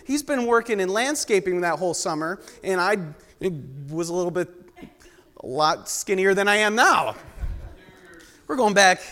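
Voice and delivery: ordinary talk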